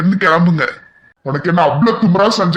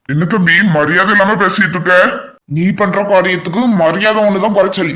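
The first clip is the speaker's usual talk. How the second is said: usual speaking voice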